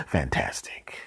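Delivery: dry whispered sarcasm, no voice; sarcastic whisper. Real, not acted. Narrator whispering sarcastically as the last fly escapes. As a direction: whisper it with a deadpan sneer.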